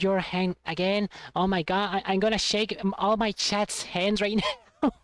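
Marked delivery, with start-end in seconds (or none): none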